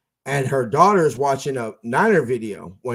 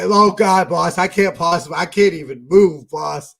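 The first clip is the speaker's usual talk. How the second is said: hammed-up sick plea, louder; mock sick groan; 4 semitones higher, 7 dB louder than usual. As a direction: play the fake flu to the boss, whining. Faking sickness to boss.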